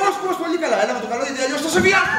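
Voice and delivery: comic-menacing outburst; heated threat. Acted. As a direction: press the threat loudly and fast. Acted threat as quarrel escalates.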